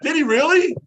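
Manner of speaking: high, loud surprised question. Real, not acted. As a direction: genuinely caught off guard. Hears of stolen base.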